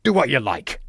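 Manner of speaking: a touch of defiance, dismissive; defiant. A narrator's reading. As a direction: shrug him off, unbowed. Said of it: "replied Troutbridge defiantly".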